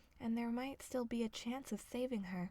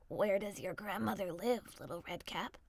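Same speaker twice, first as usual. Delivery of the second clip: lower, prying wolf voice; low cunning wolf; sly wolf; 3 semitones lower than usual. A narrator's reading. Wolf probing the girl, lower and sly.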